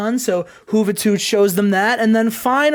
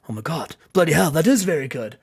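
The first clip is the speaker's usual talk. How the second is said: low, softened mock-British admission; Steven Regal; 6 semitones lower than usual. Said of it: "and he says".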